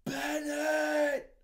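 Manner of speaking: drill-sergeant scream across the office; shouted name. Retold or imitated. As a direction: roar his name like an enraged sergeant. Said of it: "he goes".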